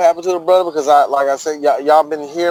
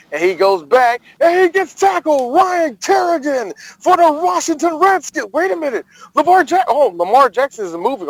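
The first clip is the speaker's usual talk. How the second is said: high, excited announcer call, sped into the moment; mock play-by-play call; 9 semitones higher than usual. Podcaster dreaming up a rival's big hit, announcer voice.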